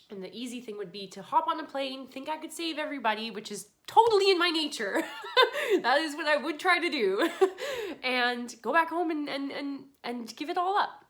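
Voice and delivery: real tearful, high voice breaking into laughs; high and loud, laughing through tears; crying. Real, not acted. Telling how she would fly home to save everybody, crying. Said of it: "I'm crying 'cause I'm emotional".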